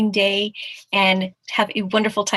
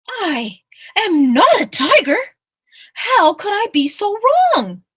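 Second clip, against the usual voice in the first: loud, high, dismayed outcry; acted shock; 12 semitones higher, 9 dB louder, slower than usual. Mouse discovering his mistake at the pond, loud acted shock. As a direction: cry it out, stretched in disbelief.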